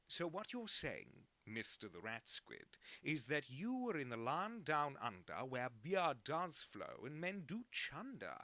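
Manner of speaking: put-on slow, hammy Australian accent; drawling bad Australian accent. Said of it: "imitating an Australian accent so badly".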